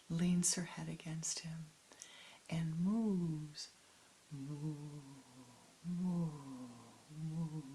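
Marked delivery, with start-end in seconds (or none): 4.3-7.6 soft lowing moo; mock cow moo. Cow moos in story.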